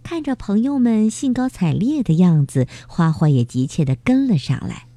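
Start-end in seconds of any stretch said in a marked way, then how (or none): none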